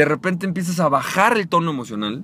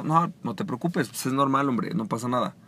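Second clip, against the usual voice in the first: reassuring; 5 semitones lower, 6 dB quieter than usual. Shown as a demonstration. Reassuring a client in a demo.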